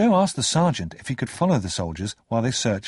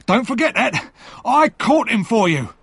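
higher, louder triumphant cry; cried out; 12 semitones higher, 5 dB louder than usual. Acted. The convict shouts to the soldiers, loud and high. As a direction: shout it proudly, much higher. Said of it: "he cried".

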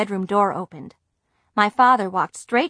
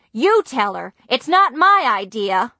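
louder, defiant retort; shouting; 4 semitones higher, 7 dB louder than usual. A narrator's reading. Girl shouts at her mother to make the call herself. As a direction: throw it back at her. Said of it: "I shouted".

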